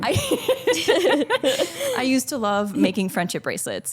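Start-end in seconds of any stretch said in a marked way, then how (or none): 0.2-1.9 high giggle; laughter. Real, not acted. Storyteller amused by own childhood business, giggling.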